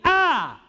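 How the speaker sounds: high, loud cry of Ah; shout. Shown as a demonstration. Showing how you can shout in life, loud and high. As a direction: let out a big yell. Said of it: "Tu peux crier".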